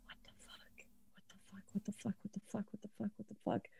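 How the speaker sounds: whispered, repeated in shock; stunned whisper. Acted. Elliot whispers to herself after the call, stunned. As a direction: breathe it out in disbelief.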